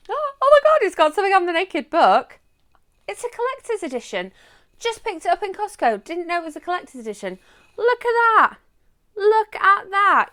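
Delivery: very high, loud delighted squeal; excited squeal. Real, not acted. Showing viewers the naked book, high excited squealing. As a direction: gush like you found treasure. Discovering hidden collector's cover.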